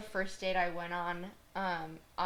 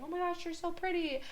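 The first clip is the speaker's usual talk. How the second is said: high, lightly voiced admiring guy; smitten guy; 11 semitones higher than usual. The date complimenting her, in a high mock-smitten voice. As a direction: play the date gushing over her. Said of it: "but he was just like".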